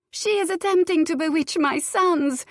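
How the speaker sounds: high, loud hysterical accusation; scream. A narrator's reading. Countess accusing the girl in front of everyone, shrieking. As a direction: shriek it for the whole garden to hear. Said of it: "Luciana screamed for all to hear".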